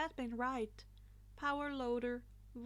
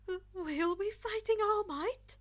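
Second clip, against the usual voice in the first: higher, louder stammered gasp; gasping shock; 8 semitones higher, 6 dB louder than usual. A narrator's reading. Momo realizing they face All Might, stammered gasp. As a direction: gasp it out in disbelief. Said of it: "Momo gasped".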